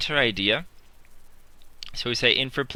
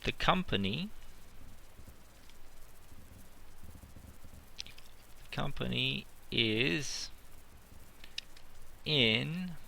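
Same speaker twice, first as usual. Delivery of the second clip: slow and softer, long pauses between words; slow dictation; 9 dB quieter, slower than usual. Shown as a demonstration. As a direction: dictate each word with long gaps. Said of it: "I can say".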